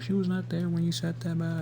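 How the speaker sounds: a hint of a whiny mom; mocking imitation. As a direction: parody her denial. Mocking mom's gaslighting.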